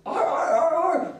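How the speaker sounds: high seal barks in quick bursts; high-pitched mock seal voice; barking seal. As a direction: bark it out like a seal. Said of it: "the seal's like".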